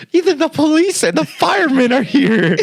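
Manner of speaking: high-pitched mock panic; alarmed mom. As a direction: imitate mom frantic on the phone. Retold mom's alarmed phone call.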